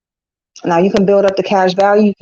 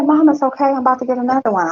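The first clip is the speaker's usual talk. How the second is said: higher, quick reassuring daughter voice; breezy daughter brushing it off, faster; mother lightly mimicking daughter's reassurance; imitating daughter; 6 semitones higher, faster than usual. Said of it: "She said".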